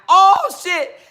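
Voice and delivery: high and loud surprised yelp. Real, not acted. Reacting to a viewer's gift, surprised yelp. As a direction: caught off guard, yelp it.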